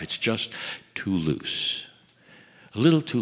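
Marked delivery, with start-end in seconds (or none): 0.0-1.9 mock doubter. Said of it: "there are those who say it's not good enough".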